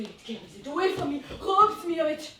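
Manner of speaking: ordinary delivery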